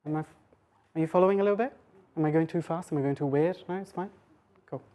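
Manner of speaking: ordinary delivery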